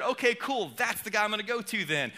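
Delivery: tossed-off eager decision; excited Jesus. Acted. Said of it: "I imagine Jesus being excited".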